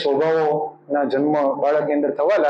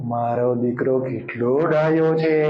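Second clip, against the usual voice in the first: tender praise; 6 semitones lower, slower than usual. Shown as a demonstration. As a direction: praise the child gently and slowly. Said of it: "આમ આવો".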